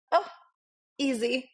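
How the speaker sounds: high, breezy mock nonchalance; faking cool. Artist faking calm before the naked model, high and breezy. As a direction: pretend it's nothing. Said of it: "So I was like".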